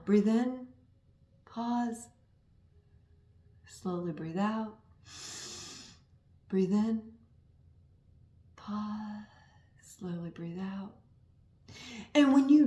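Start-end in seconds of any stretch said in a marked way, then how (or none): 0.0-10.9 softer and softer, slow breathing cues; guided breathing. Shown as a demonstration. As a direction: fade down gently like guiding a meditation. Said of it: "Do that with me now".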